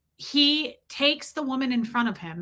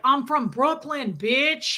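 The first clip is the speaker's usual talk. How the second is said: higher-pitched mock Brooklyn brag; Brooklyn accent; 5 semitones higher, slower than usual. Imitating the guy on landing in Nashville, mock Brooklyn accent. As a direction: imitate a Brooklyn loudmouth's boast. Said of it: "I did the Brooklyn accent".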